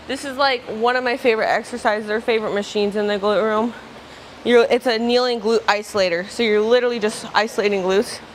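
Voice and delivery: ordinary talk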